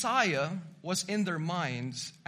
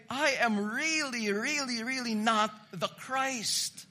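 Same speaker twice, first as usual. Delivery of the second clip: higher, each "really" punched, then a slow "not the Christ"; emphatic denial; 4 semitones higher than usual. Retold or imitated. Preacher voicing John's insistence, emphatic and higher. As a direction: stack up the "really"s and hit "not" hard. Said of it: "John wants to highlight".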